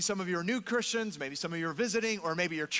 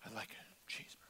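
barely audible, low whispered order; soft-spoken customer; 9 semitones lower, 18 dB quieter, much faster than usual. Retold or imitated. Older gentleman quietly ordering at the counter, soft-spoken. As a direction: murmur it like a very soft-spoken old man. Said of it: "He was very soft-spoken".

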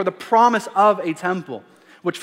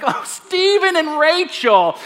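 much higher, slow, playfully wrong names; teasing dad; 8 semitones higher, 4 dB louder, slower than usual. Pretend. Father teasing his kids with the wrong names, mock innocent. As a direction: pretend to forget with mock innocence. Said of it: "I'm like".

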